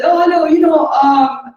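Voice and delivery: loud, high, jovial hello; hearty greeting. Retold or imitated. Father disarming the police officers, loud jovial greeting. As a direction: boom out a cheerful welcome.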